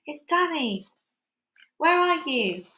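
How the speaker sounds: high, drawn searching call; shout. A narrator's reading. Child calling to hidden father, shouting. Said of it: "I shouted".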